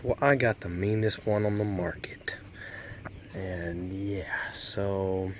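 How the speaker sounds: "Well, I got the meanest one" whispered. Acted. Truck guy bragging about his horn in a whisper. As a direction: let them in on a secret.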